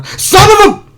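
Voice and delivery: frustrated shout. Real, not acted. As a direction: yell at the bad roll. Mod roll fails.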